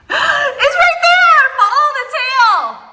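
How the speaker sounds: high, loud calls, the first drawn out slowly; excited shout. Real, not acted. Wife pointing husband to the rat, calling out. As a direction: call out eagerly to guide him.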